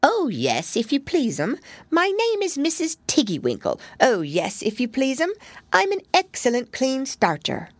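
high, bobbing little washerwoman's voice; little hedgehog laundress curtsying to Lucy. A narrator's reading. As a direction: answer with a quick curtsy, eager to please.

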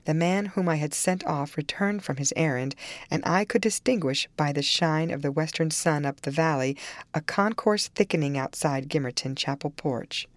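ordinary delivery

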